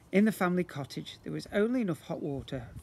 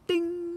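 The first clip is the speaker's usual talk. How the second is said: bright ding; 14 semitones higher, 4 dB louder than usual. A narrator's reading. Idea arrives, sound effect.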